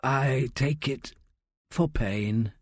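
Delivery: slow, soft stoned drawl; soft, sluggish, halting delivery; lazy speech. A narrator's reading. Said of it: "his speech lazy".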